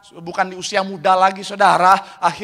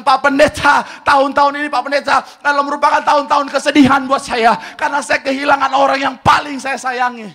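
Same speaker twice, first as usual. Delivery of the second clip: tag; 6 semitones higher, 4 dB louder than usual. Acted. Voicing a grieving church member's complaint.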